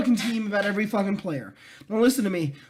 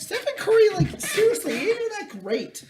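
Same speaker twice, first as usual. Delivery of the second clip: much higher, giggling put-down; giggly mock put-down of Stephen Curry; laughing mock dismissal; 11 semitones higher than usual. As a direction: squeal it through laughter.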